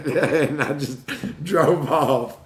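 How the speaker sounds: slow words broken by real laughter; laughing. Real, not acted. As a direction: laugh through the punchline. Laughing at his own story.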